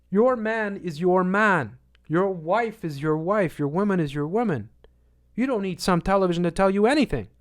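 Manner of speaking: preaching at full force. Acted. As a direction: preach it to the crowd, hammering each line.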